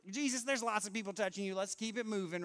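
acted-out dismissive urging; brushing it off. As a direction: hurry the crowd along like a line attendant. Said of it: "he tries to brush it off".

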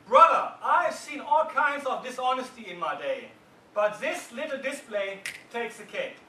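loud, heated accusation at the jury; angry juror shouting. Retold or imitated. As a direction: juror three yelling down the others. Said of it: "He starts shouting".

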